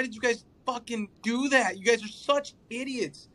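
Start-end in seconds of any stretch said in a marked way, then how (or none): none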